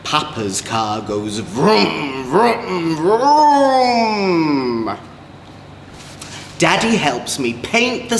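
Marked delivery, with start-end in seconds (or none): none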